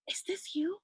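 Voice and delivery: hushed, tentative whisper imitating a stranger; retold whisper. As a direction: whisper it as if checking who someone is.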